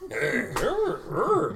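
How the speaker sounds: playful bear grunts. Acted. Bear playful noise again.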